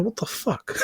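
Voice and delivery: high, soft what the fuck; stunned disbelief under the breath; baffled deadpan. Retold or imitated. Girl's baffled reaction retold.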